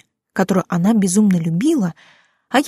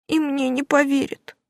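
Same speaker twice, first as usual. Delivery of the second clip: put-on high, tearful girl's voice, pleading; a crying mermaid girl apologising; tearful young girl; 6 semitones higher, 3 dB quieter than usual. Уля speaks. (плачущая девушка, высокий голос)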